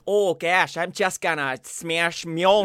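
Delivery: loud, drawn-out mock Michigan accent; hammed-up Upper Midwest accent. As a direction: put on a folksy Michigan twang. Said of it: "like, from Michigan".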